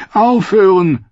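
high, loud plea to stop; high, shuddering outburst; distressed cry. Acted. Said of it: "Brienne schauderte".

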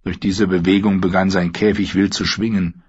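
ordinary delivery, nothing marked

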